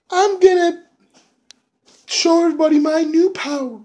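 squeaky boastful diva; high mocking woman. [name] boasts backstage.

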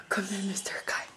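whisper. Real, not acted. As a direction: coax him in a soft whisper. Coaxing toddler, whispered take.

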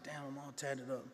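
soft, dismayed muttering to himself; dismayed aside. Retold or imitated. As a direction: retell a sinking thought under your breath. Dismayed thought to himself, retold.

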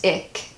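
ich with a hard k ending; mispronounced as ick. Shown as a demonstration. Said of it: "it comes out as ich".

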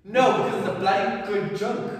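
loud defensive retort; defensive shout. Acted. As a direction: snap back hard at him.

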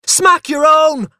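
put-on very high, loud, drawn-out child's yell; furious scream. A narrator's reading. Boy defying a threatened smacking, furious scream. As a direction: scream it back as an enraged small boy. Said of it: "I screamed furiously".

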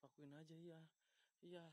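ordinary talk

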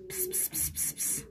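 very soft, voiceless pss-pss; animal-coaxing psst. Real, not acted. Speaker to sleeping animals, soft coaxing pss-pss. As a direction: hiss softly to get the animal's attention.

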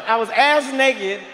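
normal talking voice

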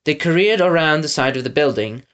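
usual speaking voice

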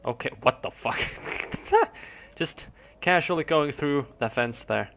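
ordinary delivery

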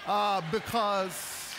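ordinary talk, nothing marked

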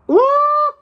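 high, loud squeal of delight; high loud surprised ooh. Real, not acted. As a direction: let out a thrilled ooh. Opens the designer bag.

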